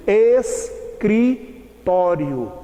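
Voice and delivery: escritório very slowly, syllable by syllable; "es... cri... tório", drawn out with gaps; each letter pronounced. Shown as a demonstration. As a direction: sound the s and c separately in escritório. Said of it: "cada letra é pronunciada, por exemplo".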